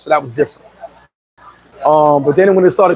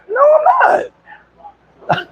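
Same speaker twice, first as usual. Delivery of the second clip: very high, yelped no; emphatic refusal. Retold or imitated. Answering his cousin's invitation, emphatic high-pitched refusal. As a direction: shoot up high into an exclaimed refusal.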